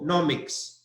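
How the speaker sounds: nomics with the first syllable NO hit hard; stress on NO. Shown as a demonstration. Teacher drills the stressed syllable for students, deliberately. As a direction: punch the no in nomics. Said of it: "The stress is on".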